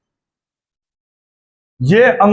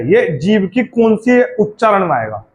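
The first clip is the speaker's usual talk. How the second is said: ordinary talk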